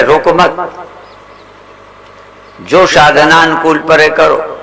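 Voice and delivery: ordinary talk, nothing marked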